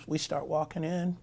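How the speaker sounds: ordinary talk, nothing marked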